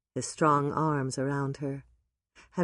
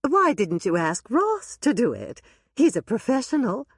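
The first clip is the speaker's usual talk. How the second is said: put-on high, louder older lady; high, louder, insistent question; Dolores, insistent; 7 semitones higher, 3 dB louder than usual. A narrator's reading. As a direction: press the point. Dolores voiced by narrator.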